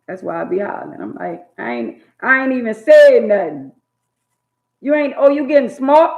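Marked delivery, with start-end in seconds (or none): 1.6-3.7 loud back talk. Retold or imitated. Child talking back to mama. 4.8-6.1 high, loud scolding mama; mock mama yell. Mama yelling at her daughter for getting smart.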